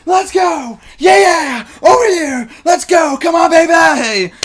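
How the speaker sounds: screamed high wrestling hype, long and loud; Jericho-esque hype entrance. Acted. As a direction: yell it like a wrestler bursting into the arena. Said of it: "as you can tell from my opening entrance there".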